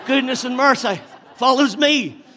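very high, full-volume shout with a laugh; exultant shout. Acted. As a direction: shout the verse in triumph on the run. Shouting the verse while being chased.

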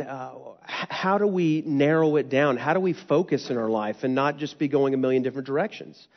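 normal talking voice

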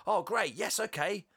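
high, eager mock-pupil voice; really excited. Class told to read a chapter, mock eager excitement. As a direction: pitch it right up, keen pupils. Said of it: "they're, like, really excited to".

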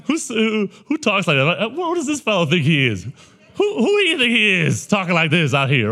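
high, loud incredulous outburst with laughter; mock indignation. Teachers wondering who this fellow thinks he is, mock indignation. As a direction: play up the scandalized disbelief, voice shooting up.

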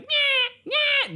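very high nasal squawk; seagull squawk. Retold or imitated. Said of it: "The seagull was like".

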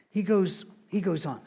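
normal talking voice

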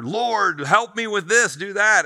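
high, loud begging to God; hammed-up plea for help; mock pleading prayer. A believer begging God to fix things, in mock pleading. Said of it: "We'll come to God and".

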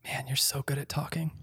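unvoiced teasing whisper to a cohost; breathy joke muttered off-mic. Real, not acted. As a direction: whisper it like a private aside.

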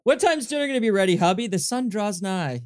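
a hint of mock vampire drama, playful; mock gothic to husband. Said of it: "I will use that in my daily life".

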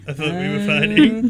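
confused creature. Retold or imitated. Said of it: "It's just confused, like".

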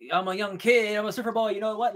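lightly hammed-up boyish character; Zachary Levi. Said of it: "Zachary Levi's like".

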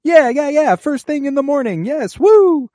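thrilled little droid yelling yes; excited whoop. Retold or imitated. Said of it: "B two is just like".

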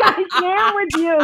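quoted through helpless laughter; can barely get it out for laughing; laughing exasperation. Retold or imitated.

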